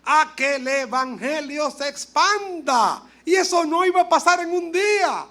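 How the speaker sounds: slow, high-pitched preaching; a touch of pulpit fervor; preaching emphasis. Acted. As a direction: lift it up and stretch it.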